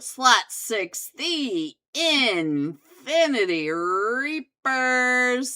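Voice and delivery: loud hyped event announcer. Acted. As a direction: boom out the lineup like a host. Announces finals teams loudly.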